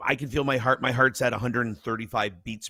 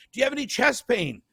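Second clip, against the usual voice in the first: high, chirpy mock nurse; 10 semitones higher, 3 dB louder than usual. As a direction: ask brightly like a nurse. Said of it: "She goes".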